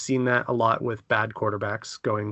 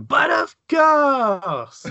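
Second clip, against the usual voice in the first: high, slow, hammed-up French accent; mock French accent; 13 semitones higher, 6 dB louder, much slower than usual. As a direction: ham up a snooty French accent. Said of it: "I suddenly started saying".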